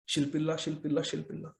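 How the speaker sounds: mocking murmur. Prince mocking bride's murmuring.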